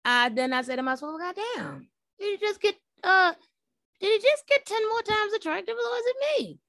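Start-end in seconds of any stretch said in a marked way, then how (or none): 1.2-6.6 very high, squeaky self-talk; high-voiced mock disbelief at an attraction; high-pitched swooning. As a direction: ask yourself way up high, smitten. Acting out smitten inner reaction.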